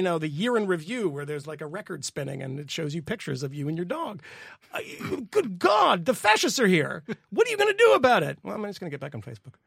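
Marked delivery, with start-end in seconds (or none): 4.7-8.4 raised, high-pitched mock alarm; theatrical call to arms, loud and high. Mock dramatic exchange about fascists arriving. 8.4-9.5 quick, quiet throwaway reply; mock indifference. Acted apathetic answer to the alarm.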